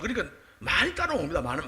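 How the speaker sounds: ordinary talk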